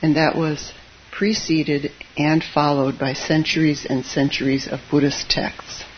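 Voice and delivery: ordinary delivery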